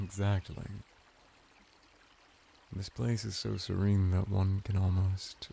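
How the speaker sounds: ordinary delivery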